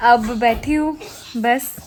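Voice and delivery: ordinary talk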